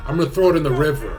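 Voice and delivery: fired-up shout. Real, not acted. Streamer telling chat he'll ditch his Canadian passport, fired-up loud shout. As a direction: blurt it out like a defiant boast. Vows to trash old passport.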